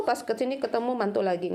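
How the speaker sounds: normal talking voice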